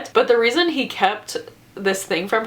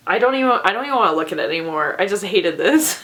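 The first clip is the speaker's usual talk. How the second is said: ordinary talk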